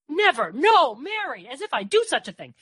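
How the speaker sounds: high, loud protest; revolted exclamation. A narrator's reading. Murph refuses Mary's suggestion, revolted and loud. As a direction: deny it with outraged force. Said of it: "Murph looked revolted".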